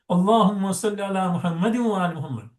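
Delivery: "Muhammadin" voweled and flowing into "wa"; no pause. Shown as a demonstration. Teacher showing the way without pausing, joined "Muhammadin". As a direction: link "Muhammadin wa" in one run. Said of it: "if you're not going to pause".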